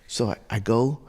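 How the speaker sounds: ordinary talk, nothing marked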